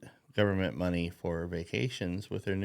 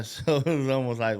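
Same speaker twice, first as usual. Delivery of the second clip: ordinary delivery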